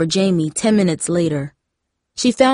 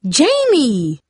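high, drawn-out cry of the name; shocked yell; 7 semitones higher, 3 dB louder than usual. A narrator's reading. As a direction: call out to stop a sister. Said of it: "Darcy yelled".

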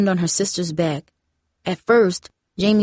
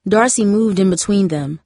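plain reading voice

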